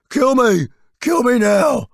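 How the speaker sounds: high, loud anguished yell; desperate shouting. Retold or imitated. Drum roadie sitting in a fountain begging, loud desperate shout. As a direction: scream it like a man at the end of his rope. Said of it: "clutching a brick and shouting".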